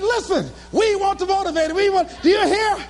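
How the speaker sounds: high, eager relaying of a chant; excited boasting. Retold or imitated. Speaker makes girlfriend hear the chant, excited. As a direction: make sure they hear your triumph.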